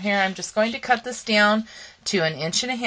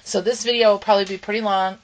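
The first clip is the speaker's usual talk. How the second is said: usual speaking voice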